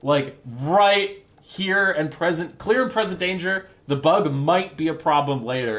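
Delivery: loud, emphatic, slowed-down protest; exasperated gunner. Acted. Gunner warning the captain of the monster, exasperated protest. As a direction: plead with your officer in mock alarm. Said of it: "his gunner is like".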